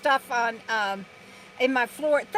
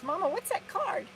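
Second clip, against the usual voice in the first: high, soft little dog voice; 10 semitones higher, 9 dB quieter than usual. Pretend. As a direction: speak for the dog, high and hushed. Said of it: "Bandit says".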